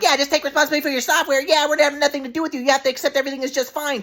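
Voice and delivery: high, fast mocking parrot of grandpa; mocking mimic. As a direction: mimic the teachings snidely and fast. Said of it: "trying to just mimic my grandpa's teachings".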